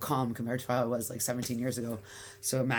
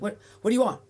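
loud and higher, blunt New York snap; aggressive bark; 9 semitones higher, 9 dB louder, much faster than usual. Retold or imitated. New York shop clerk barking at a customer, loud and higher. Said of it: "And no, no, it was, it was like".

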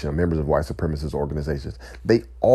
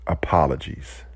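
ordinary talk, nothing marked

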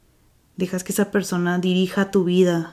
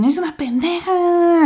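pitched way up and drawn out, an insulting shout; mock yell; 10 semitones higher than usual. Abuser yelling at partner, imitated mock shout. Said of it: "te gritan y te dicen".

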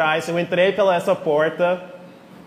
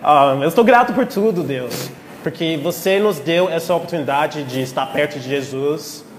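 prayer; 4 semitones lower than usual. Acted. Leads a prayer.

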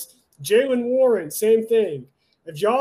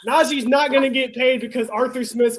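fed-up fan sounding off; heated rant; 4 semitones higher than usual. Real, not acted. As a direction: vent at the coach, voice climbing. Ranting about the coach.

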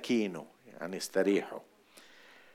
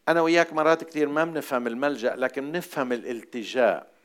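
usual speaking voice

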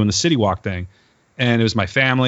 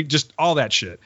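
ordinary talk, nothing marked

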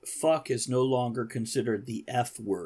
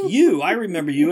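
loud, raised Roomba character voice; looming robot overlord; 4 semitones higher, 9 dB louder than usual. Acted. Roomba recognising the man in hell, loud and commanding. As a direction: announce it forcefully as the robot in charge.

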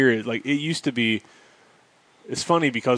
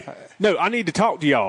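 higher, hammed-up Southern accent; teenage country accent; 5 semitones higher than usual. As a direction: play your tipsy younger self with a country accent. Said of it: "straight seventeen-year-old [name]".

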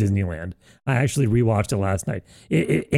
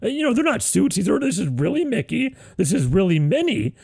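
mocking Disney fan; 11 semitones higher than usual. Host mocks cohost gushing about Mickey and Minnie, high voice. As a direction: coo over Mickey and Minnie, far higher. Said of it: "he's going".

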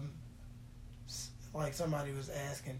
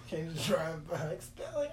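normal talking voice

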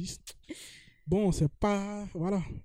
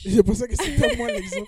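en riant; 8 semitones higher, 7 dB louder than usual. Real, not acted. Taquine son amie en riant.

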